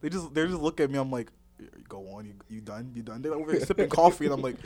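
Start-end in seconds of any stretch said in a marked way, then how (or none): 1.9-3.2 low, quiet deadpan; acted low-voiced deadpan irritation; retold dead-eyed stare. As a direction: mimic a weary, unimpressed question under your breath. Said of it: "staring at them with dead eyes".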